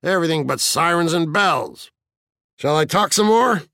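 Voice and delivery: high, gloating tease; taunting fire captain. A narrator's reading. Narrator voices Beatty gloating.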